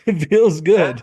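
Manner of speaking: gleeful, half-laughing insistence. Retold or imitated. Laughing defending his celebration.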